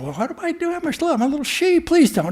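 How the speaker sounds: a scared lamb explaining itself in a rush; timid little sheep. Acted. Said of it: "the little sheep lion said".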